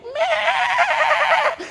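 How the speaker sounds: high, loud crying bleat of a lamb; lamb's bleat. Retold or imitated. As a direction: bleat like a lamb being slaughtered. (umiiyak na "meh" ng tupa)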